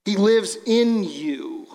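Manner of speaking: slow, gently weighted words; slow emphasis. Acted. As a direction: let it sink in, word by word. Preacher stressing key point.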